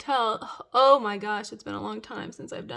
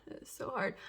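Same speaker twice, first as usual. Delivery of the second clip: softer whisper of frustration; 6 dB quieter than usual. Real, not acted. Squinting at her phone, whisper. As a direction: grumble under your breath.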